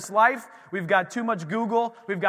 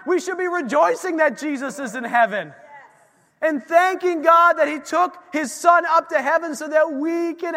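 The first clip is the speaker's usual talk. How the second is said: high, loud proclamation; impassioned preaching; 9 semitones higher, 7 dB louder than usual. Real, not acted. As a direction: rouse the congregation with full force. Preaches at full force.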